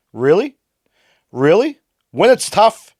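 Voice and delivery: loud high incredulous really; rising fury; outraged scoff; indignant disbelief. Real, not acted. Angry disbelief ranting at player.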